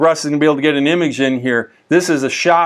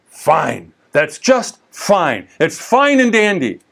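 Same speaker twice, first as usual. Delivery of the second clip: drawn-out "just fine", plainly not meant; insincere acceptance; slower than usual. Acted. Someone claiming all is fine when it's not, insincere. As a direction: let the tone contradict the words. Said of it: "you can tell by the tone".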